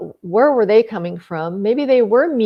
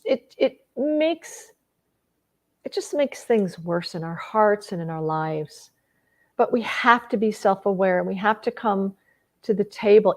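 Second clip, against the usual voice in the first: ordinary talk, nothing marked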